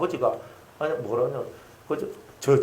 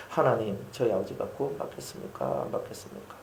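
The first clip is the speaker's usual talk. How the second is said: soft, near-whispered plea to God; hushed prayer; 5 semitones lower, 9 dB quieter than usual. Retold or imitated. Pastor praying softly over his dying father. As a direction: pray it quietly, almost under the breath.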